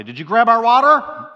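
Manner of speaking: high, loud mock husband accusation; teasing water grab. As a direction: pretend to catch your wife stealing your water, loud and high. Husband acting out water banter.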